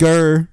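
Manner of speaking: short mock growl; impression growl. Shown as a demonstration. Demonstrating to co-host how he sounds, mock growl. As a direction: give a joking growl. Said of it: "That's what I sound like".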